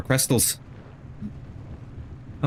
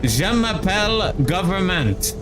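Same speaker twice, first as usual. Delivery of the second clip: lightly hammed French accent; 5 semitones higher, slower than usual. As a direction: play a silly French chef. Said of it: "a perfect Luna accent".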